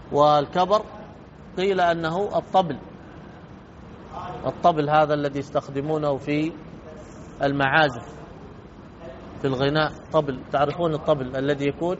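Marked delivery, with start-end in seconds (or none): none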